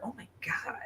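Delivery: whispered embarrassed aside. Real, not acted. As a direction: drop to a whisper, half to herself.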